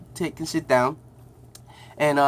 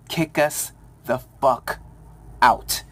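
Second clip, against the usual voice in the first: slow, deliberate challenge with pauses; slow retold dare; 4 semitones lower, much slower than usual. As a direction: space the words out, daring him. Leans forward, daring the dean.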